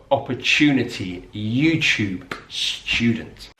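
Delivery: "opportunity, YouTube, student" each with ch for the t; British "opportunity, YouTube, student": oppa-chew-nity, you-chube, s-chew-dent; yod coalescence. Shown as a demonstration. Teacher summing up British English, coalesced pronunciation. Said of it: "we're combining it with the sound".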